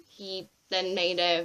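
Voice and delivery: usual speaking voice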